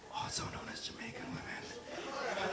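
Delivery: slow, conspiratorial stage whisper; whispered aside. Real, not acted. Comedian slipping the crowd a dig at Jamaican women, whispered.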